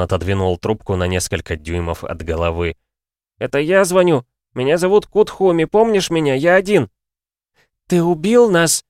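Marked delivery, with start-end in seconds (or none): 3.4-6.9 put-on high, loud, frantic boy; desperate wail. A narrator's reading. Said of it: "взвыл Кути". 7.9-8.8 high, loud accusing mother; yelling. A narrator's reading. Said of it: "заорала его мать".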